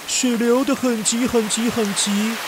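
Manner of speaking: usual speaking voice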